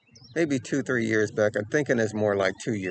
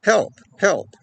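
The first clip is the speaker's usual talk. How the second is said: higher, louder mock scream for help; retold cry for help; 8 semitones higher, 5 dB louder than usual. Girl outside the door screaming for help, mock shout. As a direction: call out like someone in trouble, lightly. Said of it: "screaming, saying".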